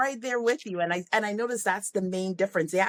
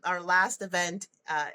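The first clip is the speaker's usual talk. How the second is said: usual speaking voice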